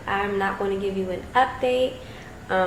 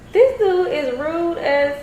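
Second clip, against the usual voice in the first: high, drawn-out offended reaction; retold indignation; 10 semitones higher, 3 dB louder, slower than usual. Reacting to a stranger's snub, high slow indignation. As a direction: stretch it out, pitched way up in disbelief.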